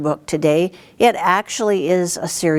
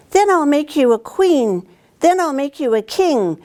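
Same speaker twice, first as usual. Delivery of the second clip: tempting witch; 9 semitones higher than usual. Acted. The witch lures Edmund with a crown, sweet and higher. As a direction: dangle the crown before him.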